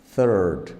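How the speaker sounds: "Third" loud, with a strong th at the start; exaggerated th practice. Shown as a demonstration. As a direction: open "Third" on a pushed th. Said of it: "you can exaggerate".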